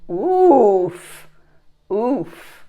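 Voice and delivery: oof. Real, not acted. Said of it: "reacting to card".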